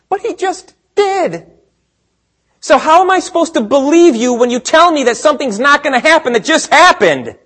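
high-pitched, exasperated disbelief; incredulous protest. Acted. Preacher voicing a baffled believer challenging Jesus, incredulous.

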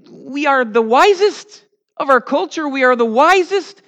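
hammed-up smug boasting of the wise; mock boast. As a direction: puff up like a self-satisfied know-it-all. Mocking the worldly wise boasting.

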